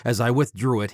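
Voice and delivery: ordinary delivery, nothing marked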